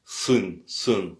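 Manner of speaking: guttural sound in "sun", said twice; "sun" as a separate guttural demo word. Shown as a demonstration. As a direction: say "sun" from the throat, each on its own. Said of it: "C'est guttural".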